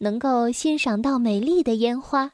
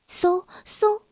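whooshing firework noise; 4 semitones higher than usual. Acted.

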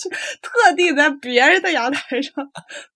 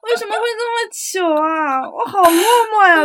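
sulky; 6 semitones higher, 3 dB louder than usual. Real, not acted. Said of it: "那种落寞的感觉".

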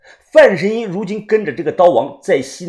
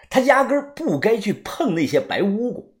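usual speaking voice

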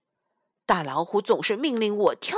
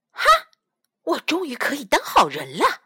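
ordinary delivery

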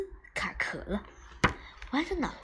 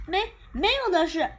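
慌忙否认; 7 semitones higher, 6 dB louder than usual. Acted. 布鲁斯慌忙否认.

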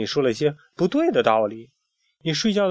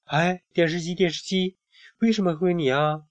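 lightly voiced child, higher pitch; a hint of a child asking; child's question; 5 semitones higher than usual. A narrator's reading. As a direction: call out a curious question, pitched up. Child character calls to the TV.

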